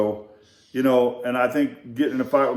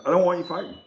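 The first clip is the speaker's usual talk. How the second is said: lecturing quote; 3 semitones higher than usual. Retold or imitated. Instructor warns the teen off fighting, raised earnest voice. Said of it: "this guy starts trying to tell me".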